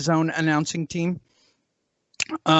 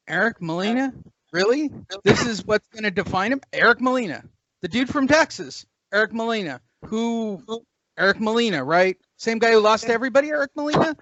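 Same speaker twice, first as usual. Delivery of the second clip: higher-pitched, scoffing disbelief; mock incredulity; 4 semitones higher than usual. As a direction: keep questioning the name in exaggerated disbelief. Mocking a supposedly career-defining opponent.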